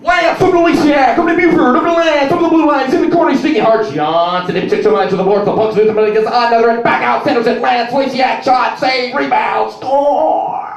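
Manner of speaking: high, loud, breathless hockey call; rapid hockey play-by-play. Retold or imitated. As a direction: rattle off the play without breathing. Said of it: "my impression of a hockey announcer".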